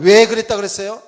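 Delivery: usual speaking voice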